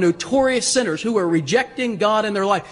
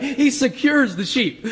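ordinary talk

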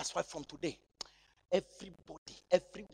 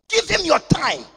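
louder and higher, peak of the call; rising preacher's climax; climactic appeal; 9 semitones higher, 12 dB louder than usual. Real, not acted. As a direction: build up to the loudest line.

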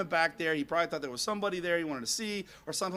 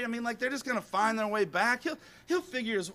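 ordinary talk, nothing marked

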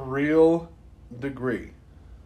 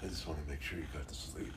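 low, fast, much softer creepy murmur; creepy watcher; 10 semitones lower, 16 dB quieter, much faster than usual. Retold or imitated. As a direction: hush it like someone looming over your bed. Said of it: "He's gonna be like".

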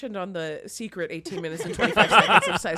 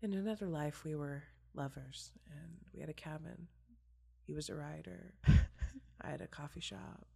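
dreamy; 3 semitones lower, 10 dB quieter than usual. Retold or imitated. Daydreaming about a stranger.

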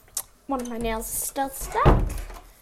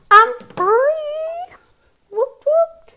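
excited cheer; 14 dB louder, much slower than usual. Real, not acted. Cheering at freedom.

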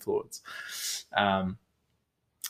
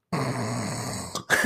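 a long, rumbly mock snore; imitated snoring; 6 dB quieter than usual. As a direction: mimic a sleeper's snore. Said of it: "I just hear this".

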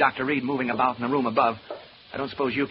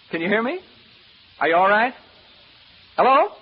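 rising calls, higher and louder each time; calling out; 6 semitones higher, 7 dB louder than usual. Acted. Man checking on the doctor upstairs, calling out. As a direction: call up to someone in another room.

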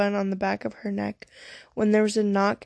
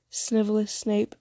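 ordinary delivery

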